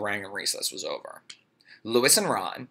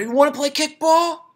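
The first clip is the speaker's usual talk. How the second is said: put-on 1 shouting boy; 8 dB louder than usual. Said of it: "Damien shouted".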